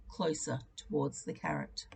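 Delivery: ordinary delivery, nothing marked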